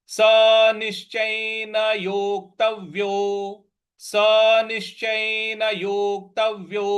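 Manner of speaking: slow, even "सनिश्चयेन योक्तव्यो"; teacher chanting the joined phrase; slow recitation. Shown as a demonstration. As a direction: recite the phrase drawn out for students. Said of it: "तो ये हो जाएगा".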